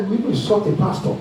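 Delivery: normal talking voice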